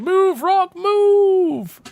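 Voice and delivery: high, very loud panicked-style yell; mock screaming tank. Charging Leclerc prototype yelling at Rock in its path, mock scream. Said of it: "screaming towards it, going".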